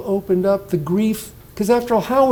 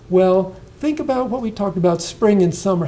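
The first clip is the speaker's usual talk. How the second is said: ordinary talk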